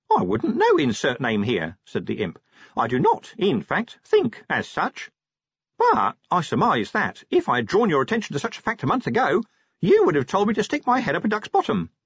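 high-pitched, fussy imp voice; high, precise imp talking back; pedantic little imp. A narrator's reading. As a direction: explain primly as a small, high creature.

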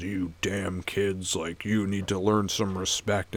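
put-on grumpy old man. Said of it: "he goes".

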